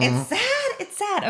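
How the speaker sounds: high, mock sad sighs. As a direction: pretend pity, squeaked high. Mock pity after the triangle joke.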